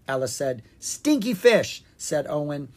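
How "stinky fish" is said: high, slow, stubborn counter; insistent. Acted. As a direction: dig in your heels. Siblings arguing over cakes.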